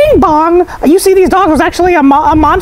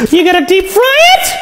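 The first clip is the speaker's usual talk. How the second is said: higher, shouted question with soaring end; incredulous; 4 semitones higher than usual. Acted. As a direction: repeat it in rising disbelief. Question repeated in rising alarm.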